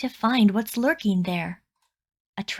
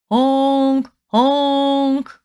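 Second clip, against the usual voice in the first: loud playful honk honk, pitched up; air horn honks; 4 semitones higher, 8 dB louder than usual. Pretend. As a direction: blast it like a big truck horn. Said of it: "you'll hear his regal air horn blowing".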